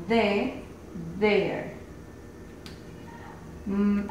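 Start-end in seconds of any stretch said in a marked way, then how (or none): 1.2-1.8 "there" repeated, falling; English TH sound. Shown as a demonstration. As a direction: say "there" again with a voiced th, falling. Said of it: "Como en su ejemplo". ("there" repetido, bajando)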